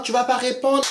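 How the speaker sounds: high, challenging taunt; taunting demand. Retold or imitated. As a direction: goad her into answering. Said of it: "Elle dit, alors".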